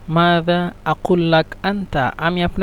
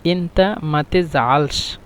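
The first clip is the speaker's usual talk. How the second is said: slow demo; much slower than usual. Shown as a demonstration. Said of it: "মিশরের ভাষা হলো".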